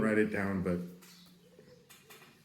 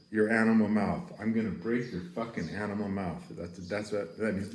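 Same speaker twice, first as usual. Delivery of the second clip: ordinary delivery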